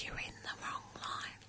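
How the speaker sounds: slow, hushed mimicked whisper. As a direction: repeat it slowly under your breath. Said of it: "she whispers in my ear".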